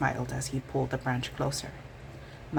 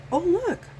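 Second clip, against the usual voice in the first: high, louder squeak of discovery; delighted surprise; 5 dB louder than usual. A narrator's reading. Mouse spotting the blackberries, squeaky surprise. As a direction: gasp it out as she spots them.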